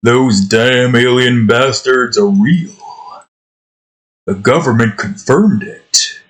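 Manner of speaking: lightly voiced action hero, slow and gruff-sounding; a hint of Duke Nukem delivering a slow, tough line; Duke Nukem impression. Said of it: "It went something like this".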